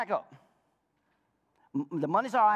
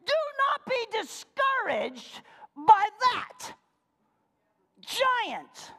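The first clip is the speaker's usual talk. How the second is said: preaching shout; 11 semitones higher, much slower than usual. Real, not acted. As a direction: cry it out over the congregation. Preacher shouting scripture to congregation.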